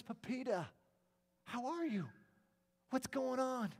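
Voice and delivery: high-pitched, happy hello; delighted greeting. Acted. Painter finds little Pepita at the museum, excited greeting. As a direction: greet her in a high, eager voice.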